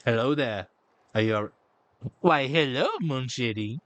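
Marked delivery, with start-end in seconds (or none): none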